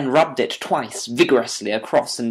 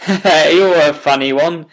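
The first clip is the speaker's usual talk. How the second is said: a slow, amused chuckle through the words, higher and louder; chuckling; 4 semitones higher, 9 dB louder than usual. A narrator's reading. Inspector chuckling at Holmes's sponge, amused. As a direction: laugh it out at the odd detective. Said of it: "chuckled the inspector".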